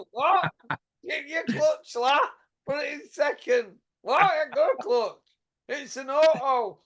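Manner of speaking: lightly voiced high bickering back and forth; hint of a confused driver; mock bickering. Acting out the two boxers' exchange.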